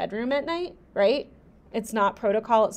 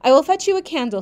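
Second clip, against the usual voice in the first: louder and higher retake; firmer retake; 6 semitones higher, 9 dB louder, faster than usual. Shown as a demonstration. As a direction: say it again with more force. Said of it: "repeated line".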